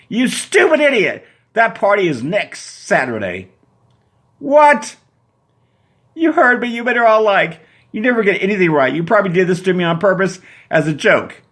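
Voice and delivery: high, loud shrieking tirade; furious Susan. Acted. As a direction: tear into the boyfriend.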